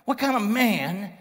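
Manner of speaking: rising, worked-up indignation; agitated. Acted. Preacher voicing a reader incensed at Jonah, agitated. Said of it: "You're kinda agitated".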